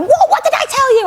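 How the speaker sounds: high-pitched retold scolding; mum going mental. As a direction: imitate an outraged mum on the phone. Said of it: "she went mental".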